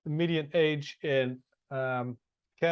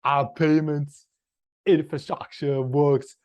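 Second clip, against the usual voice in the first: put-on slow, loud Austrian accent; Arnold Schwarzenegger accent; 6 dB louder than usual. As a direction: Austrian accent slipping toward Jamaican. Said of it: "now it's becoming a sort of a Jamaican".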